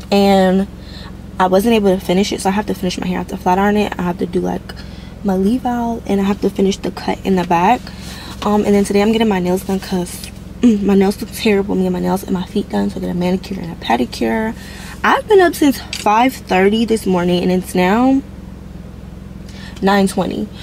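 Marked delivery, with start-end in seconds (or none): none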